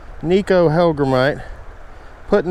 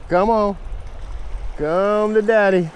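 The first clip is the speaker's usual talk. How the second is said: coaxing; 7 semitones higher than usual. Real, not acted. Coaxing the fish toward him.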